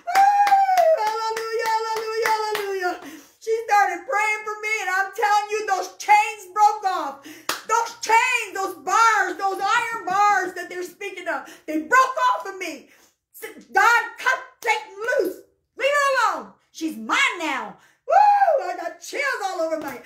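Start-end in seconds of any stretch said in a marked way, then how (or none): 0.1-3.0 loud high whoop into chanted hallelujahs; overcome with praise. Real, not acted. Overcome with praise mid-testimony. 3.4-17.8 high, impassioned testimony, stretching key words; raised, exultant church testimony; fervent preaching. Real, not acted. Preaches the deliverance at full force. 18.1-18.7 loud, high-pitched woo; sudden high holler; exultant whoop. Real, not acted. Overcome with chills while testifying.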